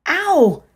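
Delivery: high loud yelp; sharp cry of pain. A narrator's reading. Dreamer yelping at sudden chest pain.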